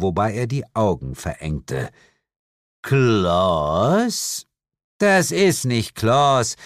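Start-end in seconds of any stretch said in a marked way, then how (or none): 2.8-4.5 drawn-out word sweeping up at the end; puzzled questioning. A narrator's reading. Devil squints at disguised narrator. 5.0-6.6 slow, higher-pitched, weary correction; bored correction. A narrator's reading. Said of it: "korrigierte Lilith gelangweilt".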